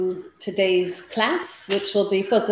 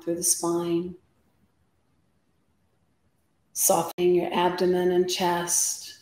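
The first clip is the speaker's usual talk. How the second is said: guided meditation. Acted. Yoga teacher settling the class before practice, guided meditation. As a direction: guide them into stillness, unhurried, phrase by phrase.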